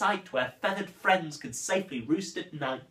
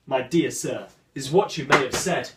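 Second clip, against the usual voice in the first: ordinary talk, nothing marked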